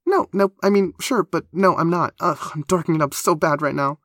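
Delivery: high, loud, flustered backpedalling; high-pitched embarrassed babble; horrified. A narrator's reading. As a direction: scramble to take it back, mortified. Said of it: "eyes wide and horrified".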